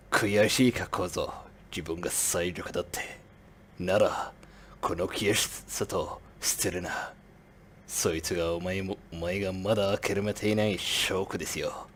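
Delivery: low, quiet brooding anime hero line; requested to imitate anime, lightly voiced stern anime mentor; anime accent. Shown as a demonstration. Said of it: "Okay, anime accent".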